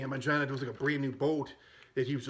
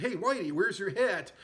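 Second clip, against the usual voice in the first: lightly voiced Dangerfield-style jab, higher; mock Rodney taunt; 6 semitones higher than usual. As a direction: tease him with a higher, cocky voice. Rodney Dangerfield taunting Ted Knight.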